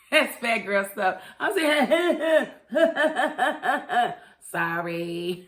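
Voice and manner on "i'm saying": breaking into laughter mid-sentence; laughing. Real, not acted. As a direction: laugh through the words. Laughing at her own eating.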